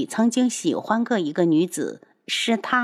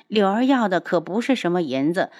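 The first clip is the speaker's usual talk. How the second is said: ordinary delivery, nothing marked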